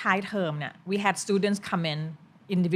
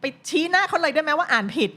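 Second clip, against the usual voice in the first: raised, high-pitched playful challenge; higher, animated mock suggestion; mock confrontational; 9 semitones higher, 4 dB louder than usual. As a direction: egg the student on, half-joking. Mock advice to confront colleague.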